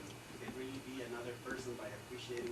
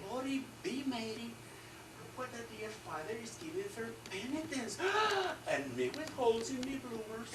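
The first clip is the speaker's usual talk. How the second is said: light Irish brogue, a hint of an old lady, high; 10 semitones higher, 4 dB louder than usual. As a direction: play the scandalised old Irish woman, lightly. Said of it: "she says to the other".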